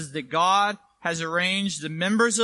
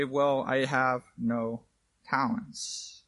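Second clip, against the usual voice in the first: lower, soft, slow whiny excuse, fading to a breath on talents; mock excuse voice; 6 semitones lower, 6 dB quieter, much slower than usual. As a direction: play the lame excuse, lightly mocking. Mocking a typical excuse.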